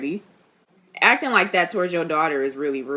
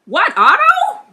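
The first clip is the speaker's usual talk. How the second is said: high, loud shocked cry of the name; shocked outburst; 9 dB louder, slower than usual. Real, not acted. Viewer shouting at Otto on screen, shocked outcry. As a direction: blurt it out in disbelief. Shocked reaction to Otto's scheming.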